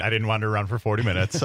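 ordinary talk, nothing marked

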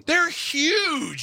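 high, drawn-out cry of amazement; aghast exclamation. Real, not acted. Cohost reacting to video of cicadas landing, aghast exclamation. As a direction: blurt it out way up high.

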